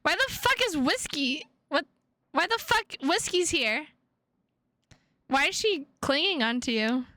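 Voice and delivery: disbelieving outrage; mild outrage. Acted. Sees captor has her cat.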